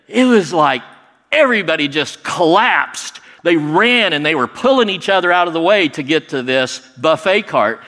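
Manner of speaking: ordinary talk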